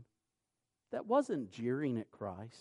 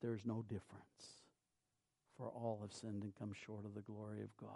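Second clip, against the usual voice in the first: fast breathy whisper, much softer; hushed whisper of scripture; 5 semitones lower, 12 dB quieter, faster than usual. Acted. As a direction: breathe the verse out as a hushed secret. Opening scripture line, whispered.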